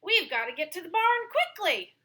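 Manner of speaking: fast, high and urgent. Acted. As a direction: hurry everyone along. Dog urges animals to barn.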